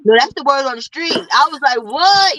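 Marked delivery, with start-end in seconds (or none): 1.8-2.4 higher-pitched, louder disbelieving exclamation; retold surprise. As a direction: replay your shock at the gossip. Quotes own reaction to gossip.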